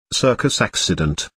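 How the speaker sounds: ordinary delivery, nothing marked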